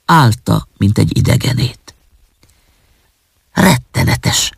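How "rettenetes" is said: slow, hushed inward exclamation; said to oneself. A narrator's reading. Orphan to herself at the funeral, slow hushed horror. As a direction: start high, then let it sink slowly into breath. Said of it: "mondta magában az árva".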